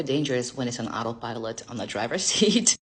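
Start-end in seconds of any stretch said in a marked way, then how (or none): none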